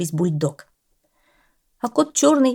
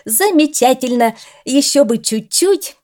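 high, loud, cheering; the coach cheering the dog after a poor jump; approving exclamation; 7 semitones higher, 6 dB louder than usual. A narrator's reading. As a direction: praise him eagerly. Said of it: "одобрительно воскликнул".

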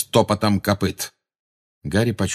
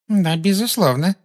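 high-pitched, mildly anxious agreement; somewhat uneasy; 10 semitones higher than usual. A narrator's reading. Umbridge agrees with Hermione, somewhat uneasy. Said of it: "с некоторым беспокойством".

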